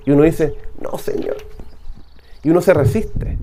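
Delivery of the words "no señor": breathy whisper of no; whispered refusal. Acted. Reluctant believer whispering back to God, refusing. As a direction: mutter the refusal under the breath.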